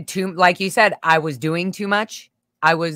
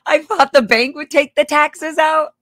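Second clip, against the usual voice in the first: laughing; 9 semitones higher, 5 dB louder than usual. Real, not acted. Laughing at her naivety.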